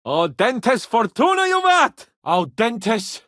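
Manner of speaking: loud Latin rallying cry; battle motto. Real, not acted. Rallying the men with a loud Latin motto. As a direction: proclaim the motto to rouse men.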